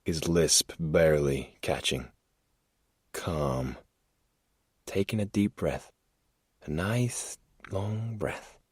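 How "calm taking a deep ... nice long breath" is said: soft, coaxing breathing instructions; soothing. Acted. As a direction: lull her into calm. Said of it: "he said in a soothing tone".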